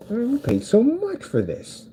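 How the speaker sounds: very high, slow mock whine; mock dismay. Ticket buyer lamenting the cost of the show, high mock whine. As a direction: wail it high and drawn out. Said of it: "The guy was like".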